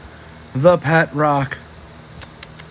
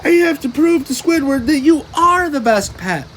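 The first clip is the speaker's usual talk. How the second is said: much higher, desperate plea; pleading; 8 semitones higher than usual. A narrator's reading. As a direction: beg your pet to try. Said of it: "SpongeBob said".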